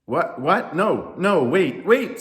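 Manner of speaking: loud, high flustered objections; alarmed protest. A narrator's reading. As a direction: cut off the praise urgently. Moses protests the crowd's praise.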